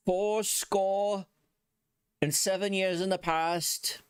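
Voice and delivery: a hint of a solemn Lincoln address; mock Abraham Lincoln. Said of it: "an Abraham Lincoln quote".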